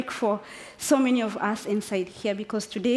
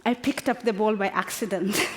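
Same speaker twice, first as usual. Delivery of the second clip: ordinary talk, nothing marked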